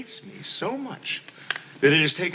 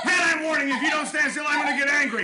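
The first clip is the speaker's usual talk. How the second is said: higher, warning yell; threatening shout; 7 semitones higher than usual. Acted. Husband warning his wife, shouted threat. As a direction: threaten her, barely holding back.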